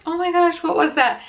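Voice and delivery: high-pitched mock alarm; retold startled cry. Passengers react to the tire noise, retold startled surprise. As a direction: imitate startled passengers without raising volume.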